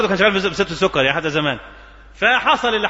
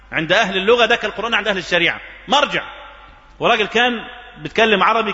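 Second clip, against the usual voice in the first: ordinary delivery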